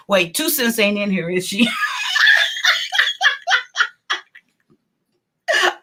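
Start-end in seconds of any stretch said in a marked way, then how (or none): 1.7-3.9 high shrieking laugh burst; real burst of laughter. Real, not acted. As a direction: let out a squealing cackle.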